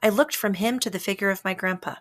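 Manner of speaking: plain reading voice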